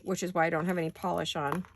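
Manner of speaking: usual speaking voice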